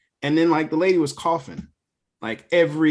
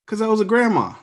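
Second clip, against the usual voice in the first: a hint of a higher wife voice; wife's reply; 9 semitones higher, 4 dB louder than usual. Wife explaining the cougher was a grandma, lightly voiced. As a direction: lightly voice the wife, matter-of-fact. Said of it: "She's like".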